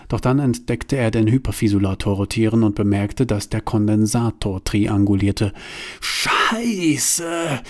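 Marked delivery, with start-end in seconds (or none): 6.0-7.6 high, drawn-out curse in alarm; shouted curse. Acted. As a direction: let it out in a pitched-up panic. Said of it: "rief Bruno". (Bruno flucht panisch, hoch gezogen)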